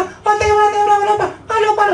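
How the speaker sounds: high, loud shouting from the crowd; crowd calling out. Retold or imitated. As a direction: yell like an eager onlooker.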